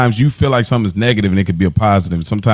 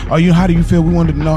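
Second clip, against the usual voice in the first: mock reporter; 7 semitones higher than usual. Mocking reporters asking mom.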